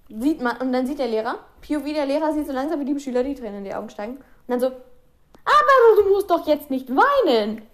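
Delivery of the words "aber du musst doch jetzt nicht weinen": high, drawn-out fake sympathy; mock consoling teacher. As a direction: coo at a crying pupil, stretching it out mockingly. Imitates teacher consoling crying pupil.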